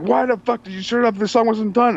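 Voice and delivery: high-pitched mock screech of outrage; imitated screaming complaint. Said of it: "starts screaming at him".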